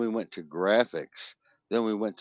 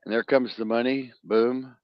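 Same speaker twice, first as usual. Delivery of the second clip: ordinary talk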